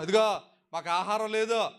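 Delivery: high-pitched grumbling crowd; complaining Israelites. Retold or imitated. Preacher acts the Israelites complaining of no food, high grumbling. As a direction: rattle off the grievances in a high, insistent voice. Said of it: "అంటున్నారంటే వీళ్ళు".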